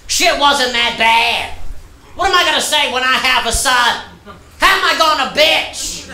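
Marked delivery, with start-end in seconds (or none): none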